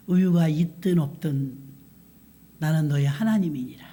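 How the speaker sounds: God speaking. Acted. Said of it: "하나님께서 마음이 이런 마음".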